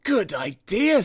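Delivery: high, eager little-engine voice; brightening at the scheme. A narrator's reading. Sir Handel taking up Gordon's idea, high and eager. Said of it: "said Sir Handel".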